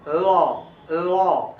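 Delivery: लॉ with tongue tip behind upper teeth; touch teeth with tongue and say लॉ; careful clear English l. Shown as a demonstration. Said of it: "ऐसे बोलना है".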